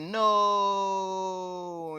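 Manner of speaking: loud, long drawn-out "no"; high, hammed-up wail of horror; mock despairing cry. Villain told he is stuck like this, mock despairing wail.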